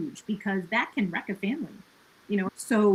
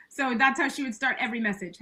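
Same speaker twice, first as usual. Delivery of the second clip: ordinary talk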